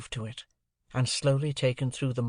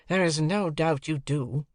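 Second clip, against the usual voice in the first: ordinary delivery, nothing marked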